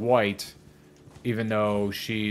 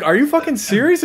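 much higher, louder shocked question; mock disbelief; 8 dB louder than usual. Imitating a host, shocked at Linus. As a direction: act stunned and appalled.

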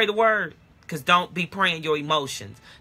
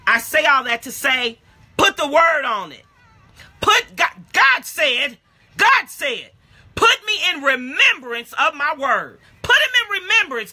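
louder, higher preacher cadence; rising preaching; 7 semitones higher, 4 dB louder than usual. Acted. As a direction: lift the congregation, voice climbing. Preaching builds.

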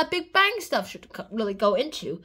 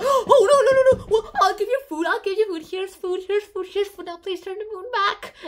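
higher, louder frantic begging; mock panic; 5 semitones higher, 4 dB louder than usual. Locals begging Columbus to restore the moon, mock terror. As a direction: beg in hammed-up terror. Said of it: "they're like".

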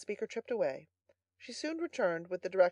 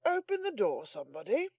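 composed butler; 4 semitones higher than usual. A narrator's reading. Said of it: "assuming perfect serenity".

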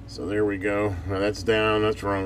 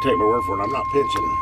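ordinary talk, nothing marked

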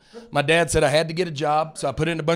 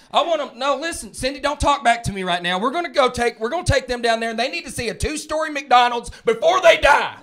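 mock-stern husband, higher pitched; bossy husband; 7 semitones higher than usual. Husband overruling wife Cindy about vacation, mock-stern. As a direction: lay down the law over the trip. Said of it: "dad arguing with wife Cindy".

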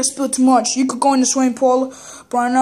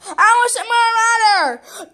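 whiny demand; 8 semitones higher than usual. Pretend. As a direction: demand it like a spoiled kid. Kid voice demanding from dad.

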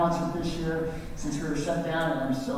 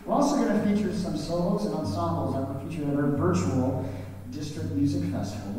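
ordinary delivery

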